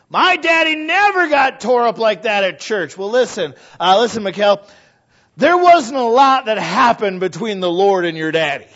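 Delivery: high, loud preaching retort; preacher acting out David answering Michal, loud and high; mock quarrel. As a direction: fling it back at her, full voice.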